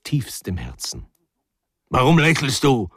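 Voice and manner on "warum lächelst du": loud, raised-pitch rebuke; angry snapping. A narrator's reading. Husband rounds on his smiling wife, angry snapping. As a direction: snap it accusingly. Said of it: "fuhr Pawel Iwanitsch seine Frau an".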